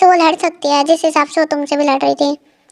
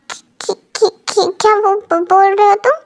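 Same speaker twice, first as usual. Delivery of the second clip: shocked stammer. Acted. Girl learns he is mafia.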